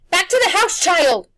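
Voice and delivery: high, loud, commanding yell; yelled order. A narrator's reading. Old woman yelling at the boy to run back, loud. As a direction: shout the child back to safety. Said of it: "Missus Griffin yelled".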